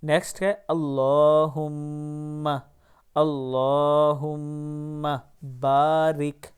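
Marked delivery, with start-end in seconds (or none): none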